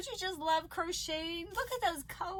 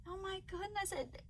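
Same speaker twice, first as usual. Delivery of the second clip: ordinary delivery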